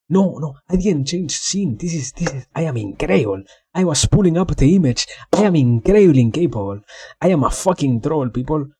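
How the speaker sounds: high, agitated self-mocking rant; flustered outburst. Real, not acted. Streamer berating themselves to viewers over a blunder, high flustered rant. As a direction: blurt it out, pitch jumping up.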